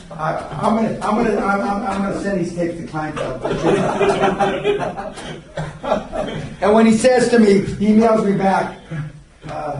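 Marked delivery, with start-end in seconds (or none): 3.4-6.6 long real laughing fit; sustained amused laughter. Real, not acted. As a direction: laugh it out, wordlessly. Laughing at his own threat.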